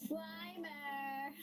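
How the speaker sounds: high, drawn-out character call. Acted. Janine calling Slimer, high drawn-out call. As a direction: call the name high and long.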